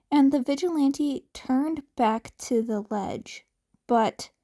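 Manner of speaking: plain reading voice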